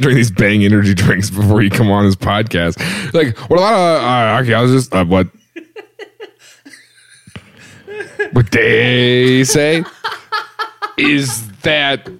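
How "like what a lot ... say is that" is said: mocking dazed cohost. Cohost teasing the host's energy-drink rambling, dazed imitation. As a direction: stall with long gaps, stretching the words. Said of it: "you look like you're seeing a lot of colors".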